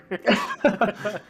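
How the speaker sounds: a burst of real laughter. Real, not acted. Player laughing at a near slur at the table. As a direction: laugh out loud at the joke.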